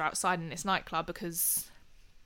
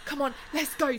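urgent call; 6 semitones higher than usual. Retold or imitated. Urging the boys to leave.

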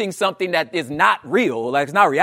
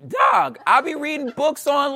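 usual speaking voice

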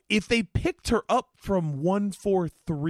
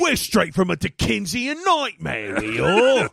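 mock Dickensian Brit; 5 dB louder than usual. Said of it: "mocking the British accent".

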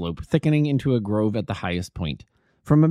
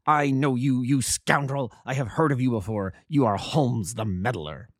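put-on angry old bully; 3 semitones higher than usual. A narrator's reading. Roylott insulting Holmes, angry. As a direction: spit the insults out. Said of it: "Roylott insulting Holmes".

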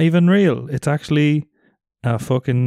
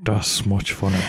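silly low voice; 7 semitones lower, 5 dB quieter than usual. Pretend. Jokey silly voice.

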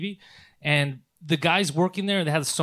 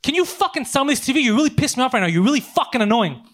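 higher-pitched, exasperated swearing; angry outburst; 7 semitones higher than usual. Retold or imitated. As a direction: snap at the clerk, fed up. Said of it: "I lost my fucking shit".

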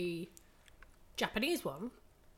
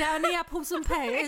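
loud, higher hammed-up Cockney, laughing; mock Cockney; 7 semitones higher, 6 dB louder than usual. Joking that the British model talks in slang, mock Cockney accent. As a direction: throw out a comic Cockney line. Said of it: "She's a Cockney".

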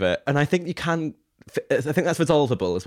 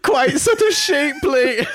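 high mock echo of the clip; 4 dB louder, slower than usual. Host echoing the judge's line from the clip, high and laughing. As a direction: quote the clip back much higher, slow and drawn out, laughing.

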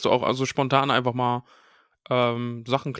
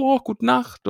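much higher, sing-song goodnight; 10 semitones higher, slower than usual. Retold or imitated. Boys calling to Hermine while slipping away, sing-song. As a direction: trill it off cheerfully.

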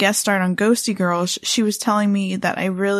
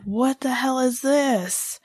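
retold freak-out; 4 semitones higher, 3 dB quieter, slower than usual. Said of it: "And so I'm freaking out".

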